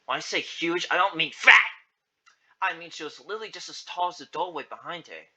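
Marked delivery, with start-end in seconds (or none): 1.3-1.6 louder, punched-out word; emphatic exclamation. A narrator's reading. Narrator clarifying Agnes's size, emphatic. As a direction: punch it out loudly.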